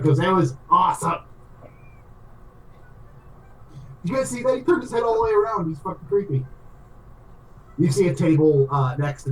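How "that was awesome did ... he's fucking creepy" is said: high-pitched, thrilled exclaiming; excited Chunt. Acted. Player as Chunt after the head turn, excited and high. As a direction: gush in a raised, excited voice.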